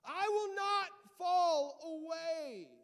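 high, loud and slow proclamation; acted full-voiced pledge of loyalty; impassioned vow. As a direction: shout it out word by drawn-out word. Re-enacts Peter's loud vow.

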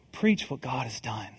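soft, low echo of the refrain; quiet repeat. Acted. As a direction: let the line settle quietly, like an afterthought.

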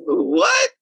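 a very high, startled what; surprised. Retold or imitated. Musician called to the airport mid-haircut: high startled what. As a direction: yelp it in disbelief. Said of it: "I'm like".

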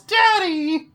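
put-on very high, loud child's cry; yelling kid. Host acts out kid interrupting the professor, high yell. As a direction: call out in a shrill, high kid voice. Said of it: "a kid walks up behind him and yells".

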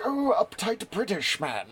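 put-on a quick jokey send-up of a stiff British gent in a British accent; uptight British accent. Podcaster mimics his inner reading voice for old writers, mock uptight British accent. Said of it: "have the same accent when I read them".

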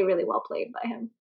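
ordinary delivery

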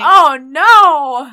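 a loud, dismayed "oh no"; a big, loud groan of sympathy. Real, not acted. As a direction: cry out in real sympathy. Friend hears of ruined makeup.

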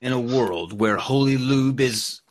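slow mock trailer narrator; portentous, slow trailer announcer parody; movie trailer guy. Shown as a demonstration. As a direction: draw it out like a film trailer. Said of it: "trailer guy".

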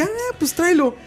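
high. Retold or imitated. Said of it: "le dije".

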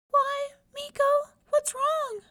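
very high, slow squeaky mouse voice; a tiny mouse asking her sad friend; squeaky little mouse. Acted. Little mouse asks Meeko what is wrong, squeaky high.

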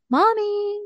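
put-on high creepy child calling Mommy; eerie child voice. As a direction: call like a ghost child. Ghost child calls out.

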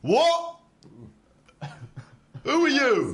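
high, loud startled cry; startled creature. Acted. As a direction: jump at the intrusion. Troll startled by intruder.

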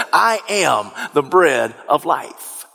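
slow, deliberate weight on "I am"; emphatic. Retold or imitated. Preacher quotes Jesus's bold claim to be bread, emphatic. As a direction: stretch "I am" and set each phrase apart. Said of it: "He emphatically says".

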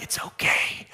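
soft, hushed comfort; soothing whisper. Acted. Rescuer calming the lost girl, soothing whisper. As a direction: hush a crying child softly.

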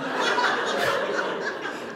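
silent wheezing laugh; breathless laugh. Real, not acted. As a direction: laugh without voice. Laughing at own awkwardness.

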